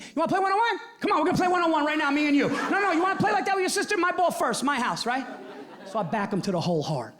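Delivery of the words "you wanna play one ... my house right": higher, louder, fast taunting dare; fired-up challenge. Retold or imitated. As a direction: throw down the challenge, pumped up. Dad challenging son to game.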